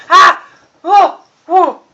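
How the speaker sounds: short, loud, high yelps; real sudden exclamations; startled yelps. Real, not acted. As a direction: cry out as if caught off guard. Startled by the distraction in room.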